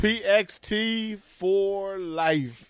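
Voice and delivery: very slow, drawn-out slogan; mock rallying cry. Host teasing guest about old school, drawn-out mock rallying cry. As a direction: stretch every word out like a school motto.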